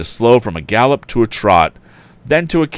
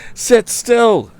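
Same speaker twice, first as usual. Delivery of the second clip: high, loud urgent shout; 3 dB louder than usual. A narrator's reading. Tom shouting to the woman on the wagon, high urgent shout. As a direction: shout it over the hoofbeats. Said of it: "he shouted".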